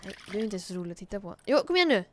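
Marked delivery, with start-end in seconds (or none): 1.5-2.0 cheering on. Real, not acted. Cheering on the fishing character.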